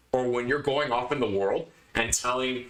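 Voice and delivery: ordinary delivery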